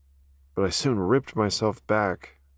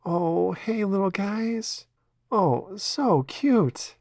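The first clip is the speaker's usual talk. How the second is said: cooing at kittens; 12 semitones higher, much slower than usual. Real, not acted. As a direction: melt over tiny kittens, drawn out.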